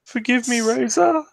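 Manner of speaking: very high, hammed-up plea; pleading Lug. Lug begs Razor after jealousy, high mock pleading. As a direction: beg in a comically high, thin pitch.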